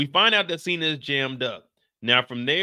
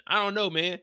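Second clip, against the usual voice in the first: normal talking voice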